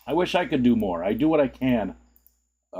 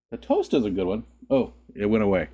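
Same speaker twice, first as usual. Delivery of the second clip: ordinary talk, nothing marked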